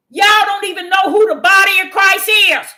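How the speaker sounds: loud rebuke to the room; preaching shout. Acted. Preacher scolding the congregation, loud rebuking shout. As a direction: call them out at full voice.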